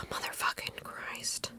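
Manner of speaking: slow, breathy exasperated whisper under the breath; whispered curse. Real, not acted. Searching her phone for the screenshot, frustrated whisper. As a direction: mutter it to yourself, almost no voice.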